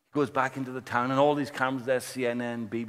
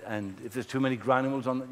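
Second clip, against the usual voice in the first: ordinary talk, nothing marked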